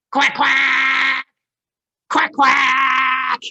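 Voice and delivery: loud, high duck quacks; loud quacking. Acted. Puppy quacking wrongly, loud and high. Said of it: "George went".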